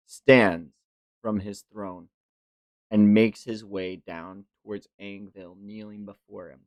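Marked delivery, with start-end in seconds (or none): none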